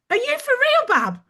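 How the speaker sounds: high, loud Brummie incredulity; playful, high-pitched amazement; mock disbelief. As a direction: squeal it in comic disbelief, breaking into a laugh. Her amazed reply to the compliment.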